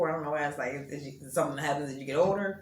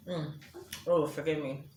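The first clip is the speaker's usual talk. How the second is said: usual speaking voice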